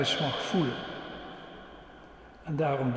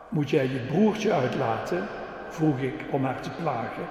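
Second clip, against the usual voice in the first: put-on lively storytelling for the kids; reading to children. Preacher telling the children a dog story, lively. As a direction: tell it like a children's story with voices.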